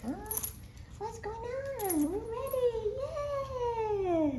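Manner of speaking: high, slow, puzzled little dog voice; voicing the dog. Owner voicing her dog's thoughts, high puzzled mock voice. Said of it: "You're like".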